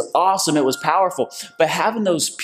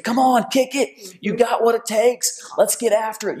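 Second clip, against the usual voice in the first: high, rousing cheer; encouraging racers; 9 semitones higher than usual. Retold or imitated. Passing racers to the man falling behind, cheering. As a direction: urge them on with raised pitch. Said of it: "but they're saying".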